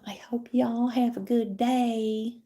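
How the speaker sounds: imitated YouTuber drawl. Vlogger signing off to viewers, in a slow imitated YouTuber drawl. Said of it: "talking like this".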